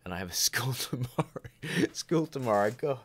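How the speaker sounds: real, helpless amusement lifting the pitch; laughing incredulously. Real, not acted. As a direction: laugh through the words in disbelief. Laughing at viewer's late-night message.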